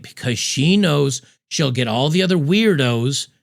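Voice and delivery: ordinary talk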